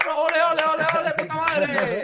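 high, loud mocking ole chant; mock neighbourhood cheering. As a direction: cheer the crash like a bullfight. Imitating neighbours cheering the accident.

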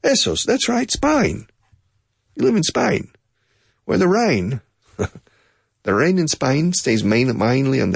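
very high, with Spain said as spine; a hammed-up Aussie voice; Australian accent. Teacher imitating an Australian speaking to him, high mock Australian. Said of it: "I can't speak like Aussies".

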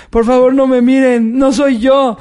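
a drunk guy caught under the stairs, mock crying; mock drunk crying plea. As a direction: whine it like a caught drunk begging. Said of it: "comenzó a llorar y a decir".

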